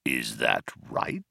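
low, slow, soft question; menacing Vogon. A narrator's reading. Vogon testing Ford after a pause, quietly menacing. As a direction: drop it low and let it hang.

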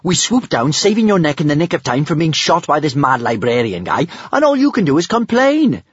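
high, protesting tirade rising to the end; indignant. A narrator's reading. Kamikaze saved her mother's neck, indignant. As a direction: protest with mounting outrage and pitch.

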